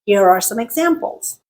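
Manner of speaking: ordinary talk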